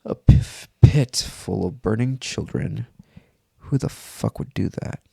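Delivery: plain reading voice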